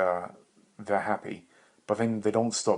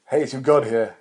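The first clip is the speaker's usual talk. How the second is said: louder, higher hammed-up god voice; loud pretend deity greeting; mock god announcement; 4 semitones higher, 10 dB louder than usual. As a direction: announce yourself as a god, grandly.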